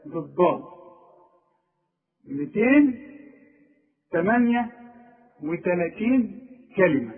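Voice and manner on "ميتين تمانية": ميتين تمانية raised high and loud, each number weighed; stressed number. Shown as a demonstration. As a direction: lift the count up to make it land. Stressing the count.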